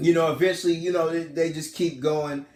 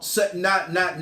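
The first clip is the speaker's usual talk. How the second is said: normal talking voice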